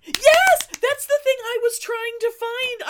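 high, loud triumphant yes; real excited yell. Real, not acted. Host hears the lost zine was found, triumphant cheer. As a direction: cheer like you just won.

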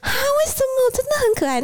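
very high, wheedling hairdresser voice; high cooing plea; pleading hairdresser. Retold or imitated. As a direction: whine a disappointed why, pitched right up.

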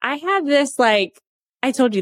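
usual speaking voice

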